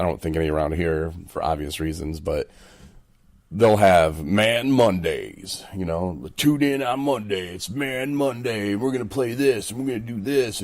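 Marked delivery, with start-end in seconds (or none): 6.4-10.6 lightly voiced cheesy radio announcer, higher; mock radio promo. Host mocking a Man Mondays station ad, cheesy announcer voice. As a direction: hype it like a station promo, tongue in cheek.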